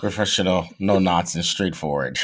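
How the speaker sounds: low, deadpan pro delivery; deep serious announcer words; professional voice. Pretend. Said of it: "That is your professional voice".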